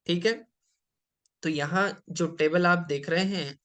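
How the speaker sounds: usual speaking voice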